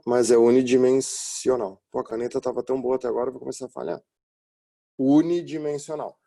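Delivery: normal talking voice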